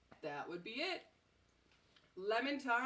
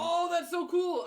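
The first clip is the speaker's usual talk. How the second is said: excited; 9 semitones higher, 11 dB louder, slower than usual. Real, not acted. Tasting new snacks, delighted.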